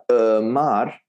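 "Amar" drawn out as uh-mar; exaggerated schwa mistake. Shown as a demonstration. Teacher exaggerates the learners' error, "Amar" as uh-mar. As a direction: overdo a wrong uh at the start of "Amar". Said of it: "I almost have to exaggerate it".

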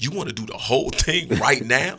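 mock astonished question; retold disbelief. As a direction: quote someone who can't believe the plan. Retold disbelief at his plan.

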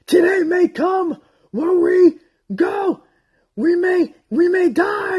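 shouted high heroic speech; rally the troops at full cry; epic battle speech. Retold or imitated. Acting out Aragorn's battle speech.

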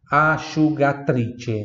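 slow asciugatrice, sci as sh; slow model word. Shown as a demonstration. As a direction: draw out asciugatrice slowly. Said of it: "Eu sempre soletro assim". (asciugatrice bem devagar)

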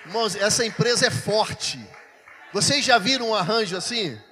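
loud proclamation over a crowd; rousing, shouted reveal; excited announcement. Real, not acted. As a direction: announce the big surprise at full voice. Excited announcement of surprise over noise.

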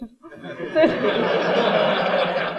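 breathy burst of real laughter; amused chuckle at the husband. Real, not acted. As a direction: laugh at your own joke.

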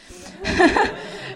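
breathy burst of laughter. Real, not acted. Laughing about the friend who loves her, breathy. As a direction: crack up briefly without voice.

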